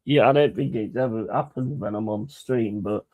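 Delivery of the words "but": ordinary talk, nothing marked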